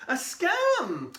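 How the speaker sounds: high shocked outburst; alarmed. Acted. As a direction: blurt it out in alarm.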